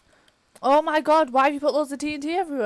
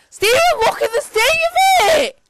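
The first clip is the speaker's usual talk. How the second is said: much higher, louder near-crying cry; anguished wail; 12 semitones higher, 5 dB louder than usual. Real, not acted. Gamer near tears calling Stephen to see the ruin. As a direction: wail his name in despair.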